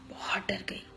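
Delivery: scared whisper. Acted. Telling how scared she was.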